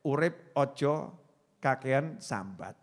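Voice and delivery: slow, measured motto reading; a preacher reading a truck driver's motto slowly. Shown as a demonstration. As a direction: read the motto word by word, slowly. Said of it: "Saya rangkum jadi pendek".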